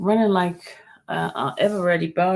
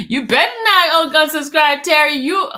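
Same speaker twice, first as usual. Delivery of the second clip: loud playful warning; mock threat; 6 semitones higher, 7 dB louder than usual. Warning viewer Terry not to unsubscribe, loud mock threat. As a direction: scold a fan with a grin.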